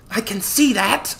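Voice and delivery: high, slow exasperated hiss; hissed retort. A narrator's reading. Narrator answers Connor's obvious remark, hissed retort. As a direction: hiss it back, drawn out. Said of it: "I hissed back".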